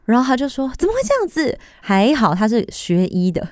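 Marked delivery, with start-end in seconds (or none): 0.8-1.6 a high, alarmed question; shocked question. Retold or imitated. Friend finding her suddenly unable to see, shocked. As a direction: gasp it out in disbelief.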